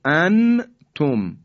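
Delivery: انتم with a clear, fully sounded n; انتم in two parts, no long hum; wrong izhar. Shown as a demonstration. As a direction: say an-tum loudly, n released before the t. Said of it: "این اظهاره".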